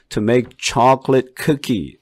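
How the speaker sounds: To make chocolate cookie very slow, stressed syllables loud; stressed model sentence. Shown as a demonstration. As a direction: read it slowly, hitting each stress. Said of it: "액센트 다 들어갔죠". (강세 살린 천천히 읽기)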